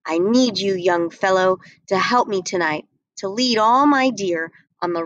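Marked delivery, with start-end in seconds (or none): none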